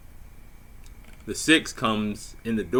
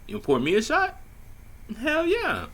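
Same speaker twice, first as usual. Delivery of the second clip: rising eager disbelief, much higher; excited. Retold or imitated. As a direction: jump at the offer, pitch soaring. His eager reaction to shot.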